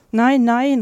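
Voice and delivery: hoch und laut, weinerlicher Protest; weinend rufen. A narrator's reading. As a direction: rufe es wie ein Kind den Tränen nahe. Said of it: "rief Marie weinend". (Marie ruft weinend gegen Fritz)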